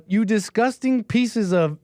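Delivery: slow, drawn-out mock outrage; imitation without falsetto. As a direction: quote the kid slowly in your own voice. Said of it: "let's not do that again".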